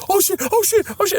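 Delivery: high, squealed mock panic; imitating panicked girls. Acting out girls spotting a bug, imitated panic. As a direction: mimic a table of girls freaking out. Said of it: "all the other girls are like".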